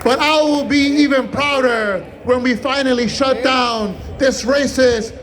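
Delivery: usual speaking voice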